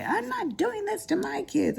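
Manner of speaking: high mocking imitation of a daughter's vow. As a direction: mimic her in a high, prissy voice.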